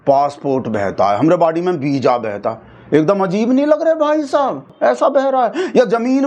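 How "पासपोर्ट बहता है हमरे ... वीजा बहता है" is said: dropped much lower, deflated; awkward deflated delivery; flat mock line. Shown as a demonstration. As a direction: let it fall flat and sound odd. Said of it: "अब इसके जगह पे आप passport बोल के देखो".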